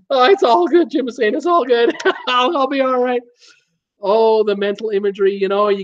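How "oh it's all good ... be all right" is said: high voice bubbling with laughter; recovering from a laughing fit, still high; laughing hard. Real, not acted. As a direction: reassure them through leftover giggles. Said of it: "I'm laughing so hard".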